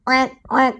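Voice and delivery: quiet high quack; duck quack. Shown as a demonstration. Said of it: "Bagaimana suara bebek?".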